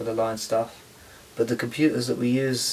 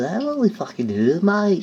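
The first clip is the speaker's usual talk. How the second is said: Australian accent; 9 semitones higher, 5 dB louder than usual. Shown as a demonstration. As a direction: play it up like a cheeky Aussie mate.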